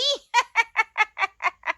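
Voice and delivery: high mocking cackle; mocking laugh. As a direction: laugh at her scornfully. (ताना मारती ऊँची हँसी)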